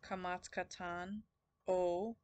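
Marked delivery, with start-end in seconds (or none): 1.7-2.2 O as in "coal", held long; long O sound. Shown as a demonstration. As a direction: say a drawn-out, loud O like the vowel in coal. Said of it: "like in coal".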